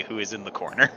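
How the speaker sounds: ordinary talk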